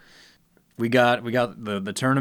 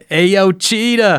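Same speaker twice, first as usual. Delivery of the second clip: high, loud, stretched catchphrase; Cheetah guy; 8 semitones higher, 7 dB louder, much slower than usual. Retold or imitated. Shouting out the Instagram cheetah cook, high drawn-out call. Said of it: "Oh, man".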